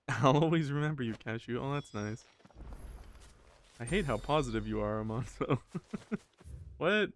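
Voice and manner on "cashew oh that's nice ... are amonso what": ordinary talk, nothing marked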